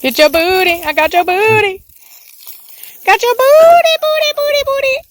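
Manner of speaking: high, loud playful taunt; teasing singsong. Real, not acted. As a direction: squeal it like teasing a puppy. Teasing dog while spraying it.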